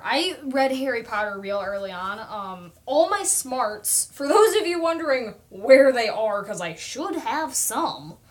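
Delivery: ordinary talk, nothing marked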